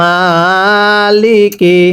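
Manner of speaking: Maliki stretched very slowly; teacher models Maliki slowly; slow drawn-out reading. Shown as a demonstration. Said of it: "আর একবার পড়তে হবে".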